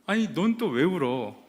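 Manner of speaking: mock irritated snap, slowed; annoyed. Brother irritated at crying sister, mock annoyed. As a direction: let the irritation show on "넌 또 왜 울어". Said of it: "막 짜증이 밀려오는 겁니다".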